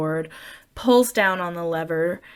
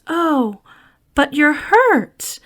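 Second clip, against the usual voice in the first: mild concerned Janet; 9 semitones higher, 5 dB louder, much slower than usual. Retold or imitated. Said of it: "And she goes".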